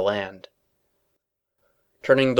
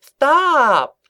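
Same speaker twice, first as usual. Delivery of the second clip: high dazed squeak, louder; 8 dB louder than usual. A narrator's reading. Filly squeaking as she crumples to the floor. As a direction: squeak it out as you fall. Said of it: "she squeaked in a daze".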